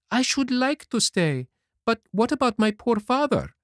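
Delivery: put-on boyish Pinocchio voice. A narrator's reading. To the fairy, Pinocchio speaks in a high boyish voice.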